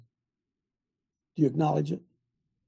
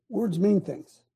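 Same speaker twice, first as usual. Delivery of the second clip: ordinary talk, nothing marked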